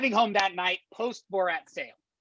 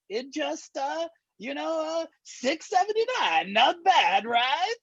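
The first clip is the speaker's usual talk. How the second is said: put-on high, slow Borat accent; high Borat accent price pitch; 8 semitones higher, slower than usual. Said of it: "I do the Borat".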